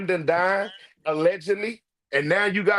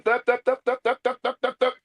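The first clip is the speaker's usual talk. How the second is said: beatbox; 3 semitones higher, faster than usual. Retold or imitated. Imitating the beat.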